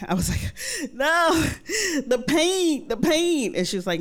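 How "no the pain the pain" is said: high, strained insisting; high pleading about the pain; pained protest. Retold or imitated.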